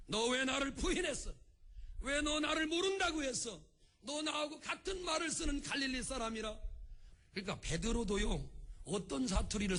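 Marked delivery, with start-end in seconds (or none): 0.1-6.6 mild, higher-pitched mock rebuke; 통렬한 질책. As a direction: press the accusation with raised pitch. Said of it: "예수님이 통렬하게 그를 질책할 줄로". (통렬하게 꾸짖는 척 높은 어조로)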